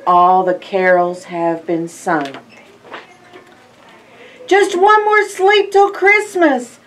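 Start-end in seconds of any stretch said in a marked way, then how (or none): 4.5-6.8 much higher, louder joyful refrain; excited. Acted. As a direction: cry out the countdown.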